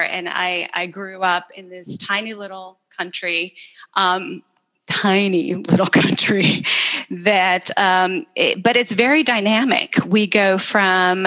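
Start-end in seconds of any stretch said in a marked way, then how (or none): 4.9-6.6 laughing emphasis. Real, not acted. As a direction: lean on tiny, laughing through the phrase. Repeats it with a laugh.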